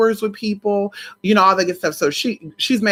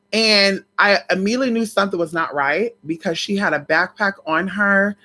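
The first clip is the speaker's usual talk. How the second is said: ordinary delivery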